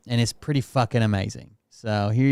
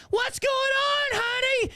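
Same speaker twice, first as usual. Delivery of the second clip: very high, drawn-out holler; hammed-up shrieking neighbor lady; calling out; slower than usual. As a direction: holler to a kid across the yard. Neighbor calls to fleeing boy.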